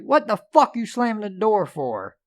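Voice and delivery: angry dad. Retold or imitated. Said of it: "my father was like".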